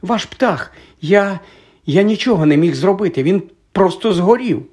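high, halting panic; alarmed boy blurting an explanation; nearly gasping. A narrator's reading. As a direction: stammer it out high, almost breathless. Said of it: "мало не задихнувся Гаррі".

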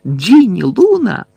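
high, loud calling of names; крик. A narrator's reading. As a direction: call out urgently to missing friends. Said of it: "закричав Гаррі". (гучний високий оклик)

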